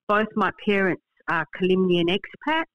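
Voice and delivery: ordinary talk, nothing marked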